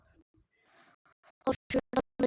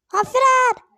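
high, loud sudden cry; startled shout; 11 semitones higher, 10 dB louder than usual. Real, not acted. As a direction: yelp it out in alarm. Sudden alarm in game.

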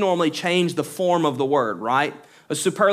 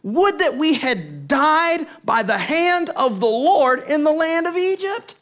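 higher, whining wish to have died; sermon quote of the people's bitter lament; complaining Israelites; 9 semitones higher than usual. Acted. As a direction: voice the grumbling crowd, pitched up. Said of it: "the people of Israel said to them".

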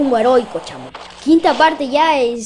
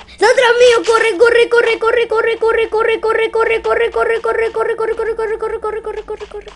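high, loud panicked cries of corre; panicked yelling; 10 semitones higher, 5 dB louder, slower than usual. Real, not acted. Gamer chased by enemy, panicked high yelling. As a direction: yell to yourself to run, panicking.

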